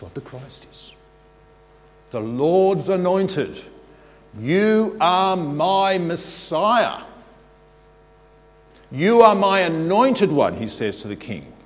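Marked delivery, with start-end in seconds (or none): 2.1-10.6 solemn proclamation of God's words. Acted.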